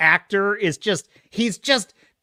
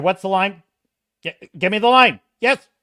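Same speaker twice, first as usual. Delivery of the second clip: ordinary talk, nothing marked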